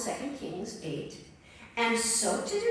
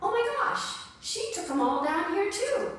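surprised; 9 semitones higher than usual. Real, not acted. Shocked reaction to Tyre.

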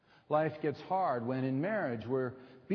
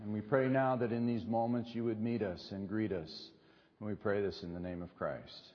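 prayer; 4 semitones lower than usual. Acted. Opening prayer before sermon.

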